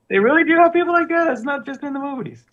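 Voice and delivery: high, fast, still amazed. Real, not acted. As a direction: rush it out in disbelief.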